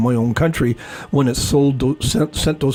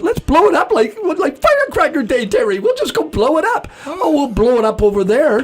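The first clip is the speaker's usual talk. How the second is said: high, giddy pitch of a warmonger; mock glee; 11 semitones higher than usual. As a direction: cheer it on like fireworks day. Acting gleeful warmongers.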